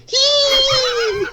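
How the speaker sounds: very high shrieking squeal; startled squeal. Acted. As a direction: let out a shrill yelp. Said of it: "Big Macintosh sees this and goes".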